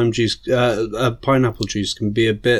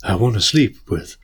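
Shatner impression; 5 semitones lower than usual. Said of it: "where he's like".

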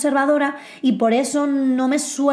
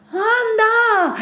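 mock surprise; 8 semitones higher than usual. Imagined surprise watching news.

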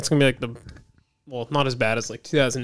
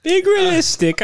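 mocking; 4 dB louder than usual. Echoing the mocked phrase.

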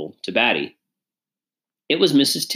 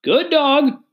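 high, loud mock praise of a dog; falsely friendly, pitched way up; insincere praise; 4 dB louder than usual. A narrator's reading. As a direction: praise the dog without meaning it. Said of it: "Batty could tell he didn't mean it".

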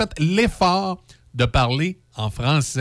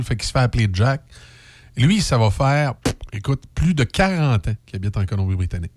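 usual speaking voice

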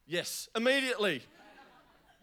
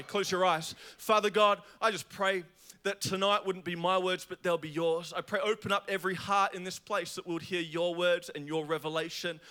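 ordinary delivery

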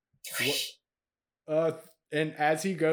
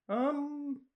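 sheepish goblin; 6 semitones higher than usual. Acted.

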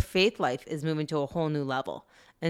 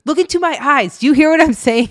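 mock stern scolding; 9 semitones higher, 10 dB louder than usual. Kids quote mom's fight.

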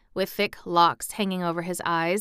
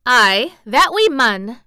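put-on girl's proud try at broad Yorkshire, louder; 5 semitones higher, 11 dB louder, slower than usual. A narrator's reading. Mary answering Dickon in her new Yorkshire, eager. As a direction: lean into the Yorkshire vowels. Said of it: "So she spoke a little now".

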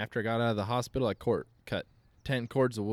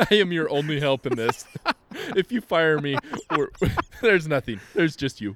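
high, loud laughing protest; laughing mock protest; laughing plea; 5 semitones higher, 7 dB louder than usual. Real, not acted. Pleading not to be fired.

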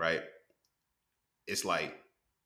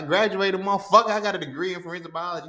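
proud retelling; 10 semitones higher, 6 dB louder than usual. Retold or imitated. Retelling the comeback again.

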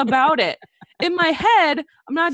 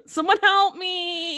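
mock pleading; 6 semitones higher, slower than usual.

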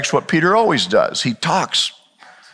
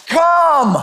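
Jesus calling; 11 semitones higher than usual. Acted. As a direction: beckon him out of the boat. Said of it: "the voice says again".

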